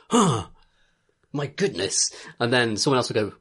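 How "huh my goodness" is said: lightly voiced posh chef discovering something; a mild put-on voice for a fussy game chef, pitched higher; hammed-up gasp of culinary inspiration; Ignis. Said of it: "he snaps his fingers and goes".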